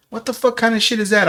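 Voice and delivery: high, exasperated disbelief; incredulous disgust. Retold or imitated. Scolding a man who lets his girl speak, incredulous.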